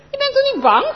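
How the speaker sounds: high, loud teasing of a scared friend. Acted. Chris to Anneke, high loud teasing. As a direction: tease her, pitched high and loud. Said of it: "plaagt Chris".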